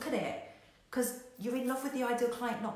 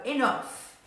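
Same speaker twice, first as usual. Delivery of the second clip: firm; 4 semitones higher, 6 dB louder than usual. Retold or imitated. Calm person stops the panic.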